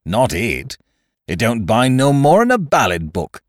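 dismissive pirate scoff; mildly mocking retort; derisive. A narrator's reading. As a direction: wave it away with derision, rising mid-phrase. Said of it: "returned Silver derisively".

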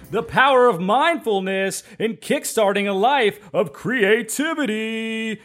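high sing-song showman intro; sing-song like a lounge emcee; ringmaster. Acted. Musical guest intro.

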